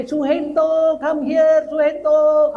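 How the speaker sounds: high, loud call across the parking lot. Retold or imitated. Lady calls the pastor from her car, loud high call. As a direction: yell his name across the lot. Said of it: "Dia teriak-teriak saya".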